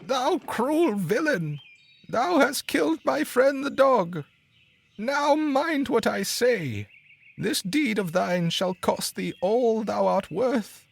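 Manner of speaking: very high, slow accusing cry; angry sparrow. A narrator's reading. Sparrow threatening the carter who killed the dog, slow high angry cry. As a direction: condemn the villain slowly and fiercely. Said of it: "cried the sparrow".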